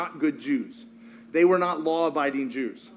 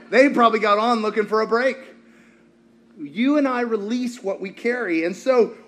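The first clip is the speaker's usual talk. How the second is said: ordinary talk, nothing marked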